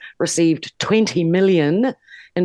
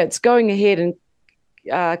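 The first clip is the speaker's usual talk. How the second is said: usual speaking voice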